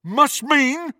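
high, loud, scornful question; ferocious echo. A narrator's reading. Crabbe turns on Malfoy, ferociously. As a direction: throw the words back angrily. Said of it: "Crabbe turned on Malfoy with undisguised ferocity".

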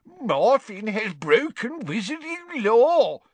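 high, slow, mocking sing-song; pompous sing-song mimicry. Father imitating the official's words, pompous and sing-song. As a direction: parrot it pompously, stretching every word. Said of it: "making it pompous and sing-song".

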